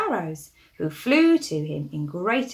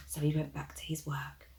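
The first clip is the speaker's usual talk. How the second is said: relieved whisper; 5 semitones lower, 11 dB quieter than usual. A narrator's reading. Relief after chase in story.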